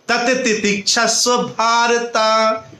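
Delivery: very slow, drawn-out syllables; slow, measured teaching line; solemn verse recitation. Real, not acted. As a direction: stretch each word like a recited verse. Recites a verse as closing lesson.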